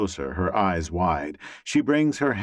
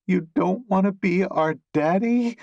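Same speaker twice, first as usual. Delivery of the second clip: mock innocent child; 12 semitones higher than usual. A narrator's reading. Her grin's pure evil.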